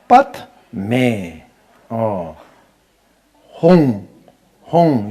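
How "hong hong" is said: "Hong" with a wide-open mouth, full and loud. Shown as a demonstration. Teacher showing the right syllable, loud open "Hong". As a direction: sound "Hong" fully voiced, mouth open. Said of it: "You have to open your mouth".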